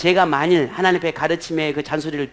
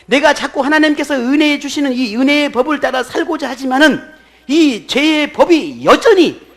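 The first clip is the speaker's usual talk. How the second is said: high, loud, ringing sermon delivery; full-force preaching; 9 semitones higher, 10 dB louder than usual. Real, not acted. Pastor proclaiming God awakened the conscience, full-force preaching. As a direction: drive each phrase home to the congregation.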